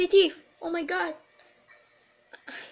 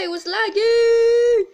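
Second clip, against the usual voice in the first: whiny complaint; 8 semitones higher, 8 dB louder, much slower than usual. Real, not acted. Complaining after wrong way.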